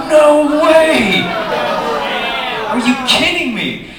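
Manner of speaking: high, loud shout of disbelief; outraged disbelief. Retold or imitated. Comic reliving spotting the limping man's scam, outraged. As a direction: cry out like you've just been conned.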